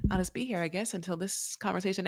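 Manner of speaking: soft, acted sheepish aside; quietly sidelined, mock resignation; awkward aside. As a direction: say it small, as an awkward bystander. Awkward bystander left waiting during call.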